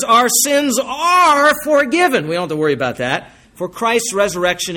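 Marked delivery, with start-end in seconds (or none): 0.0-2.3 higher, louder and slow, near tears; choked-up, tearful proclamation; voice breaking with emotion. Real, not acted. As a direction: let it catch in the throat. Preacher moved by forgiveness.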